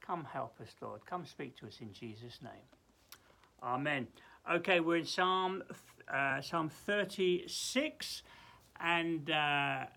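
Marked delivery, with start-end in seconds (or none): 0.0-4.1 slow, hushed earnest prayer. Acted. Prays aloud.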